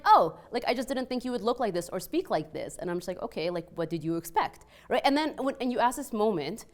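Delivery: normal talking voice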